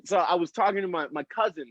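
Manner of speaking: usual speaking voice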